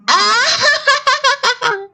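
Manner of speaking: high, shrill mad cackle; crazed laughing to scare her; bizarre laugh. Acted. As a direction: sister laughs madly at the prisoner. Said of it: "She burst out into a bizarre laugh".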